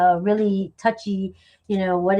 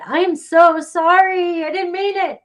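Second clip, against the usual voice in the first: dramatic apology; 8 semitones higher, 7 dB louder than usual. Shown as a demonstration. Reader showing how she would dramatically say sorry, high and loud. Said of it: "That's the way I would say it".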